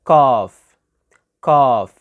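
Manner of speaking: "cough" said as "caahf", vowel held; silent L, long AH. Shown as a demonstration. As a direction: model the word written "cough" here as British "calf", no L. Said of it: "وال إل هنا بتبقى silent".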